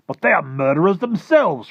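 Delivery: high, loud military protest; indignant cry. Acted. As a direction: protest at full volume as an outraged army chief. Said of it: "cried the head of the army".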